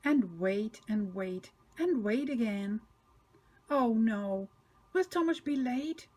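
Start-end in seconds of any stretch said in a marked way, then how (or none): none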